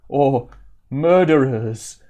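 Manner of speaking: slow, loud, ominous whisper of fear; wide-eyed dread. Acted. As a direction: draw it out, spooked. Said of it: "His eyes widen a bit more".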